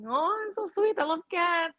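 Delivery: high, soft, put-on sweetness; mock-sweet, tiny high voice; sweet cat lady. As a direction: coo it softly in a high pretend cat-lady voice. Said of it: "We pretend to be like cat lady".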